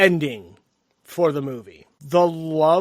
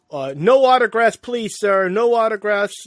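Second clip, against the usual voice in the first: louder, higher pretend crowd control; mock minder; 5 semitones higher, 7 dB louder than usual. Host plays someone keeping lover boy from the singer, mock officious call. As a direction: fend off a fan like a pretend minder.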